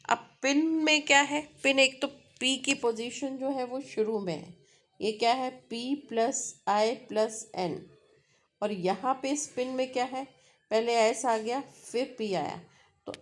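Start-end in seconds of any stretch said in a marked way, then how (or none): none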